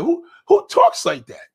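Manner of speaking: high-pitched, drawn-out disbelief; baffled, scornful outburst; incredulous. Real, not acted. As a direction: let the pitch leap as you question it. Incredulous at the boast.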